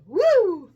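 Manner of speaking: high, loud "woo"; one big high-pitched cheer; excited whoop. Real, not acted. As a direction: let out a celebratory whoop. Mock cheer at video length.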